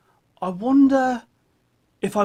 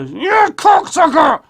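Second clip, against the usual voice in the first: high, loud puppet shout; 11 semitones higher, 8 dB louder, slower than usual. Acted. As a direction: yell it in the puppet voice.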